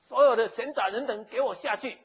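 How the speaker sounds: slow, loud order to clear the room; royal command. Acted. Pharaoh orders everyone out, loud and slow. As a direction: bark it out like a king dismissing servants. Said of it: "宴好了以后就说".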